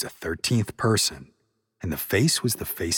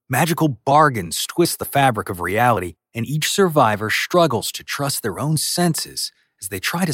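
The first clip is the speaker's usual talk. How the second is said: upbeat, louder promo read; 4 semitones higher, 4 dB louder than usual. Acted. Host recommending a podcast to audio fiction fans, upbeat ad read. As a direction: pitch the show to listeners.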